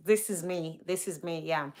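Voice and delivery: usual speaking voice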